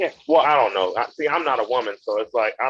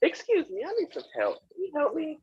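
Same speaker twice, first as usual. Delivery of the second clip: helpless lady; 6 dB quieter than usual. Retold or imitated. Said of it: "her way over to me and be like".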